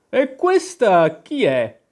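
a haughty queen demanding an answer; stern queen. Acted. Queen sternly asks the knave who Alice is, slow and loud. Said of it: "con tono severo".

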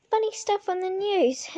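usual speaking voice

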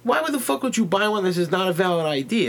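high-pitched exasperated retelling; retold argument. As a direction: act out scolding the seller. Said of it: "as I'm arguing with this lady".

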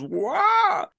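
a high, hammed-up falling yelp; cartoon cry of a falling cop; mock yelp. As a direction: squeal like a cop falling off a bike. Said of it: "the cop on the motorcycle goes".

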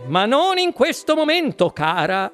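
giving in, with swooping pitch; lightly voiced harried dad buying time; placating father. A narrator's reading. Father promising his daughter one later, placating.

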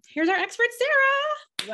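game-show host reveal; announcer shout. Acted. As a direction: introduce her like a star at a gala. Announcing the guest with flourish.

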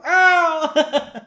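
high, loud "ow"; cartoon cry of pain; mock pained yelp. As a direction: yelp as if something landed on you. Barney hit by falling table.

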